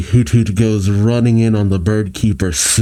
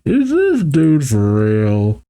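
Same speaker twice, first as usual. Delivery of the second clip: slow, drawn-out, higher-pitched disbelief; stretched-out background aside, pitched up; incredulous bystander; 4 semitones higher, slower than usual. Acted. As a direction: draw it out in mock disbelief. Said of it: "Joey in the background being like".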